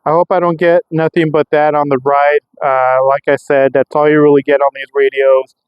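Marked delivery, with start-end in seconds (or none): none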